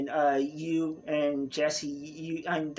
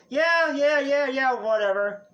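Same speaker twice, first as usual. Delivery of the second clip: high, louder, dismissive yeah-yeah; annoyed brush-off; 7 semitones higher, 4 dB louder than usual. Acted. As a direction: wave it off, fed up. Annoyed girl brushing off order.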